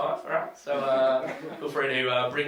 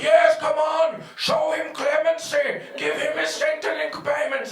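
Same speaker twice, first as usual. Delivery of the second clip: rally for mercy with high excitement; eager courtiers; 8 semitones higher than usual. Acted. Liberal supporters beg Tony for clemency, high and eager. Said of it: "they're like".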